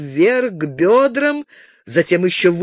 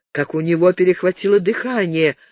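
plain reading voice

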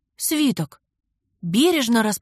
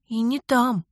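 ordinary delivery

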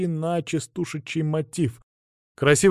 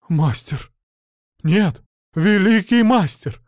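rising to a loud awed cry; overwhelmed admiration building to a shout; awed outcry; 6 semitones higher, 6 dB louder, slower than usual. Acted. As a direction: bard crying out in awe to his new master. Bard in awe begs.

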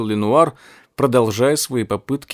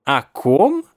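higher, mocking scientist voice; gloating old professor; 3 semitones higher than usual. A narrator's reading. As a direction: sneer at him like a mad scientist.

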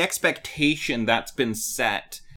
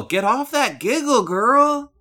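high-pitched playful catchphrase, rising louder; hammed-up telling-off, pitched way up; mock scolding; 11 semitones higher, 4 dB louder than usual. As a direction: sing out a joking reprimand. Mock scolding catchphrase about Giggle.

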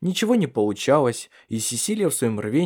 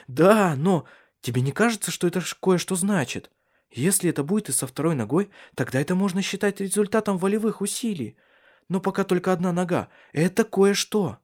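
higher woman's voice, insistent; eager Cecilia; 7 semitones higher than usual. A narrator's reading. Woman arguing that it means something, high and eager. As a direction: press the point hopefully, pitched up.

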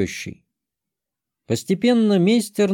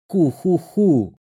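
slow gloating villain chuckle; evil chuckle; 5 semitones higher, much slower than usual. Acted. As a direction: savour a sly sinister laugh.

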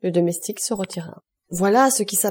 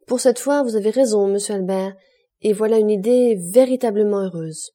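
ordinary delivery

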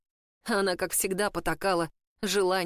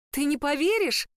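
forced bright cheer, higher; fake cheerful; 6 semitones higher, 3 dB louder than usual. A narrator's reading. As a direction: put on a cheery front. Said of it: "наигранно весёлым голосом произнесла она". (наигранно весёлым голосом)

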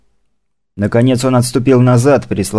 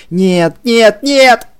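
higher and louder with every no; hysterical scream; 13 semitones higher, 5 dB louder, much slower than usual. Acted. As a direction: scream the nos in rising panic. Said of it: "пока она не закричала истерически".

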